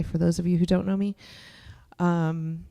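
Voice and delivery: ordinary delivery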